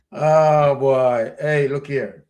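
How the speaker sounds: usual speaking voice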